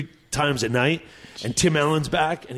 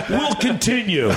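Rodney; 8 semitones higher than usual. Retold or imitated. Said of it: "unfolds his piece of paper and goes".

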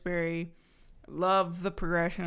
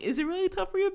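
incredulous; 13 semitones higher, 4 dB louder, faster than usual. Retold or imitated. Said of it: "I'm like".

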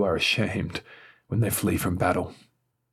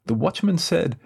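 ordinary delivery

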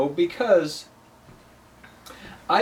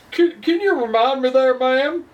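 cowboy charmer; 6 semitones higher than usual. Pretend. Jokey cowboy voice continues.

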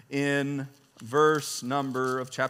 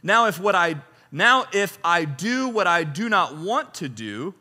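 raised, declaiming reading voice; scripture reading; 5 semitones higher, 5 dB louder than usual. Acted. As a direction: read Paul's words like a proclamation. Reads scripture aloud with force.

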